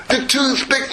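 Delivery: ordinary talk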